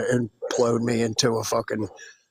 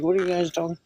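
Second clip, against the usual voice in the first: higher-pitched puzzled retort; confused; 5 semitones higher, faster than usual. Retold or imitated. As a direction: ask it as if baffled, imitating yourself. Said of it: "It was like a confused thing".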